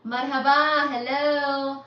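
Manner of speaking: bright, loud hello to the chat; excited greeting. Real, not acted. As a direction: call out a big welcome. Greeting chat viewers on stream.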